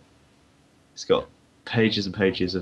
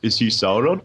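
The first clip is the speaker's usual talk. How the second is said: confused classmates; 3 semitones higher, 8 dB louder than usual. Retold or imitated. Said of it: "they were like".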